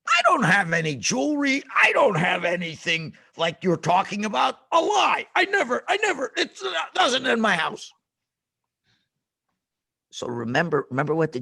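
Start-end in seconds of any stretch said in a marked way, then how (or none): 0.1-7.9 high loud flustered denial; denying neighbor. Retold or imitated. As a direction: bluster a lie to cover up. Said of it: "and he goes".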